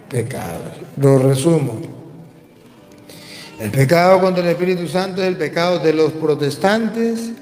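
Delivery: ordinary delivery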